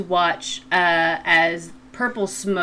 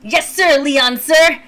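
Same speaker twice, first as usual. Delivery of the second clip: eager henchman; 7 semitones higher, 7 dB louder than usual. Acted.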